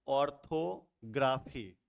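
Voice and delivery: Orthography slowly, with a break before graphy; slowly divided word. Shown as a demonstration. Teacher letting the class count, orthography slowly divided. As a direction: draw out ortho, pause, then graphy. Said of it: "कितने आ गए".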